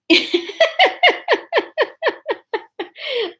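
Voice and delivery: long high giggling fit; helpless laughter. Real, not acted. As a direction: crack up at your own bad accent. Cracks up at own failed accent.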